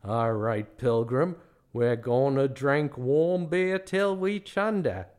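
slow drawn-out movie-star impression, badly muddled; John Wayne doing Cary Grant. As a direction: do a bad, drawling Hollywood cowboy gone Australian. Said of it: "sounds like John Wayne trying to do a Cary Grant impression".

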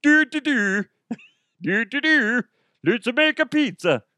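high, loud puppet-like singsong babble; hammed-up cartoon voice with a silly foreign accent; Muppets character. Comic recalling that guy from the Muppets, hammed-up puppet voice. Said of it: "that guy from the Muppets".

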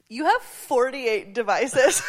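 laughing through words. Retold or imitated. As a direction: giggle through the line. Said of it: "she was like".

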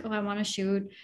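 ordinary talk, nothing marked

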